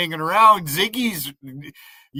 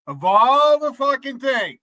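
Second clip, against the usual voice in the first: outraged; 7 semitones higher, 3 dB louder than usual. Real, not acted. Outraged over mother's picture.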